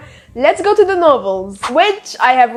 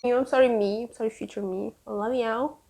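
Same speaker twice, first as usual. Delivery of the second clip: soft apology; 11 dB quieter than usual. Real, not acted. Soft apology to future self.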